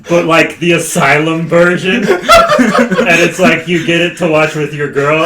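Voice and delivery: loud, high, laughing through the words; laughing riff. Real, not acted. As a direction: crack up while pushing the joke out. Cracking up over own movie riff.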